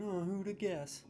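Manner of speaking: ordinary talk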